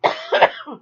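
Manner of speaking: high loud burst of laughter. Real, not acted. Laughing at herself.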